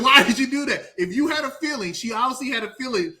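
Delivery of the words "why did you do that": mock shout. Mock questioning the character.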